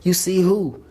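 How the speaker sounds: high-pitched retold disbelief; startled question. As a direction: act out an astonished parent. Acting parent's startled question.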